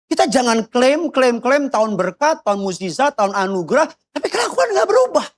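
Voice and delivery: high-pitched, repetitive admonishing; insistent preaching. Real, not acted. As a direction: climb to a high exasperated finish. (khotbah menegur dengan nada tinggi)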